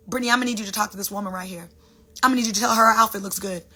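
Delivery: quick, matter-of-fact instructions; God giving instructions. Acted. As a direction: rattle off the errand briskly, as God. God giving her brisk instructions.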